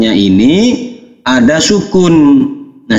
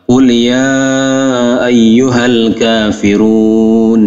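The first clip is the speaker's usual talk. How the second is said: slow, melodic tajwid recitation; Quran recitation; much slower than usual. Shown as a demonstration. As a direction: chant the verse slowly like tilawah. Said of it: "recites verse slowly". (bacaan tartil yang lambat)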